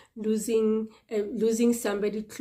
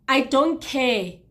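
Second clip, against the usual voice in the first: flat retort; 4 semitones higher, 6 dB louder, slower than usual. Retold or imitated. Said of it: "retort to "we love you".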